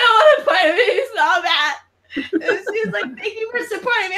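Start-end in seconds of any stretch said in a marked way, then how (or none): 0.0-1.7 high sobbing wail; ugly cry. Retold or imitated. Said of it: "you do an ugly cry too". 3.2-4.2 high sweet voice. Retold or imitated. Gaga's reply, mimicked.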